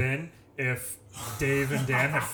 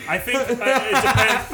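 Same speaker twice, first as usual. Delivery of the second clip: laughing; 7 semitones higher, 5 dB louder, slower than usual. Real, not acted. Laughing while answering a question.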